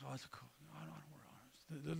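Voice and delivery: barely-there whispered shrug of a voice; lazy passive husband. Acted. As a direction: whisper like a man too lazy to care.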